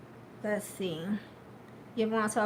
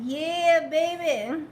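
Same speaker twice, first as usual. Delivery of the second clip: excited cheer; 6 semitones higher, 6 dB louder, much slower than usual. Real, not acted. Cheering a family shoutout.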